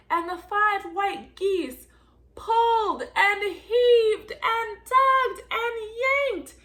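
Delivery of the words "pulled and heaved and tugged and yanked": slow, higher and louder, pushing with effort; straining effort. A narrator's reading. Telling the children the big pull, straining loud. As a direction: drag the words out as if hauling.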